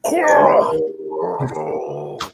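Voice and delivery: "Carl" stretched very long, dropping to a low creak; mock the show's "Carl", hugely drawn out and low; exaggerated character imitation. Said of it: "You gotta say, "Carl".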